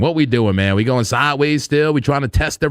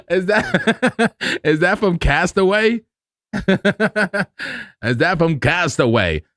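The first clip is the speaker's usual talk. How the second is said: high-pitched words between laughs; cracking up; 5 semitones higher, much slower than usual. Real, not acted. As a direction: barely get the question out for laughing. Cracking up at Wilson joke.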